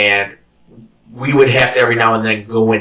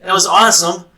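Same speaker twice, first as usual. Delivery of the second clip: inner excitement; 8 semitones higher, 3 dB louder than usual. Retold or imitated. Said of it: "in my heart, I'm like this".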